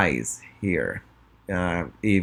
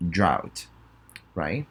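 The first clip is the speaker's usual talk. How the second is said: ordinary talk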